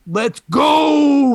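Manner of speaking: loud, high, drawn-out hype yell; imitated kids' yell. Parent imitating kids' TikTok catchphrase, loud yell. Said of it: "my kids will always yell".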